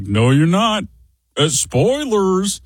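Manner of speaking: high, drawn-out "spoilers"; kid's teasing retort. Retold or imitated. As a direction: tease like a know-it-all kid. Son mocking character onscreen.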